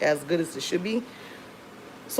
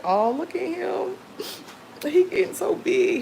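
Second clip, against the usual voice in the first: adoring coo; 12 semitones higher than usual. Retold or imitated. Mom gushing over her sleeping baby, high doting coo. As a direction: coo over him like he's the cutest thing. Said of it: "And I'm just like".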